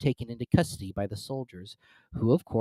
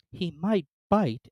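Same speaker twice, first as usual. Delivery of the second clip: slow, careful warning; cautious; 7 semitones higher, slower than usual. A narrator's reading. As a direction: say it warily, slowly. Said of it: "Alice cautiously replied".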